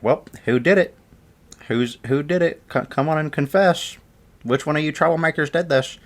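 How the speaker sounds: high, insistent mock interrogation; demanding teacher. Teacher to gathered class, demanding a confession. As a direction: imitate a teacher grilling the troublemakers.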